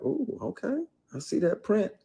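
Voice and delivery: high-pitched, teasing mock come-on; leering actress imitation. Actress ogling him on set, mock flirtatious. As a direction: coo it high, imitating a suggestive coworker. Voices the actress making advances.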